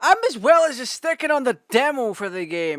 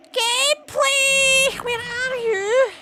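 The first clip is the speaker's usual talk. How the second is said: high, slow, drawn-out wail for the gameplay; pleading wail; 13 semitones higher, much slower than usual. Pretend. Streamer wailing at the stalled game, high drawn-out plea. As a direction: cry out like a lost child calling.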